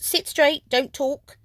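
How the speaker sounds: high, loud bossy commands; stern teacher. A narrator's reading. Teacher telling the class to sit straight, sharp and bossy. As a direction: snap orders at the class.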